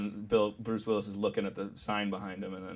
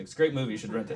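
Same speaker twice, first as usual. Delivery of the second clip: ordinary talk, nothing marked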